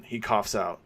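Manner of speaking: plain reading voice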